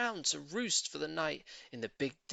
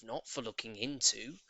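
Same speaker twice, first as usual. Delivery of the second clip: plain reading voice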